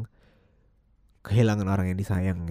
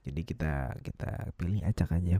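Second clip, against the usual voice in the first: ordinary talk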